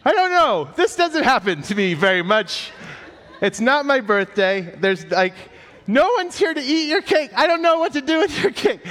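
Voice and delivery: a cartoonishly high panicked squeak; flustered panic. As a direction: sound completely out of your depth and panicking. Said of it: "And I'm like".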